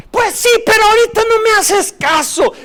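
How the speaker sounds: very high, loud shouted rebuke; Balaam shouting while beating the donkey; furious shout. Retold or imitated. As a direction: yell it at the donkey in a rage.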